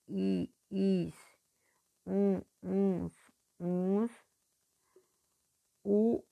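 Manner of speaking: normal talking voice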